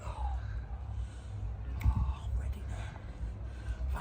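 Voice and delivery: very quiet, slow whisper; barely audible, drawn-out whisper. Real, not acted. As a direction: whisper it under your breath. Getting ready quietly before his set.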